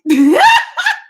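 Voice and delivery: high squealing laugh; real laugh bursting out; squealed embarrassed laugh; burst of laughter. Real, not acted. Laughs about dropping the program.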